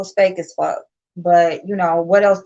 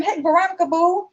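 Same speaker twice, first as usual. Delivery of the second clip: sweet mock greeting; 8 semitones higher than usual. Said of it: "Goddess was like".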